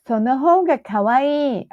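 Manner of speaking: put-on high cute girlish voice; high cute anime girl. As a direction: squeal it like an anime heroine. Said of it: "why do I do the anime voice".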